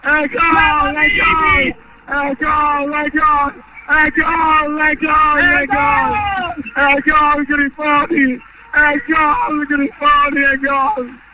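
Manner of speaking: endless chant of the goal call; breathless italian goal commentary. Real, not acted. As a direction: repeat the goal cry breathlessly.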